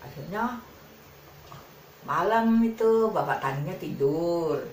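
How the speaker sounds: usual speaking voice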